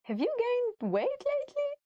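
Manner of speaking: very high, sugary fake-friendly question; mock innocent question; sweet innocent tone. Shown as a demonstration. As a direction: ask it as if it were a compliment. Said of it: "never say it in a mean way".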